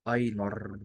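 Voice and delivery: أينر with a long, leaned-on final R; hard German R. Shown as a demonstration. Teacher stressing the ending for learners, hard final R on أينر. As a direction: press on the R at the end of أينر. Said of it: "شوف بتك على الار ازاي".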